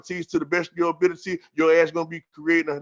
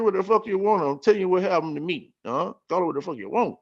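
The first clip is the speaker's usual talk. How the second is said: ordinary delivery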